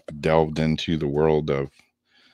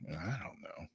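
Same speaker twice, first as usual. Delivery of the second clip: whisper; 18 dB quieter than usual. Real, not acted. Muttered aside to himself.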